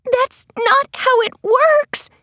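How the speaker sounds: high, slow, protesting cry; shouting back. A narrator's reading. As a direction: pitch it way up and stretch it out. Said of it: "Maddie shouted back".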